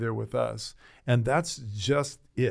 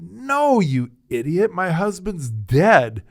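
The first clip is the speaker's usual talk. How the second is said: a high, loud cry of "No" and a slow "My husband's dead"; a grieving widow lashing out at a clueless young pastor; furious retort; 7 semitones higher, 10 dB louder, slower than usual. Retold or imitated. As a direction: snap at him in grief and anger. Angry patient scolds pastor.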